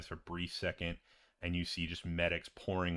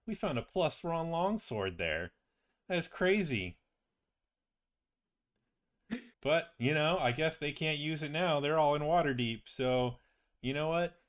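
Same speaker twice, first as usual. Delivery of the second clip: higher-pitched, animated character voice; excited wizard companion; 7 semitones higher than usual. Acted. Friend tells patient about the longsword, excited and breezy. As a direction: marvel at the find, then shrug it off breezily. Game master voices Doggold on the sword.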